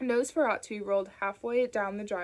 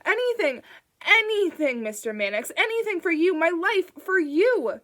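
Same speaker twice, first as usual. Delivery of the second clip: high, loud, desperate wail; frantic loud begging; squalling plea; 9 semitones higher, 9 dB louder than usual. A narrator's reading. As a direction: howl your devotion as you're dragged. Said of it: "squalling and yanking at the handle".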